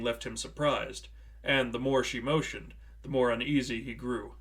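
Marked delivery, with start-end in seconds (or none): none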